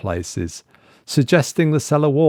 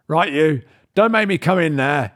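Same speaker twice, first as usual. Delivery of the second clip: raised, calling into the hole; called out; 6 semitones higher than usual. A narrator's reading. Ted calling into the tunnel, raised and fed up. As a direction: call out sharply into the dark. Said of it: "he called out".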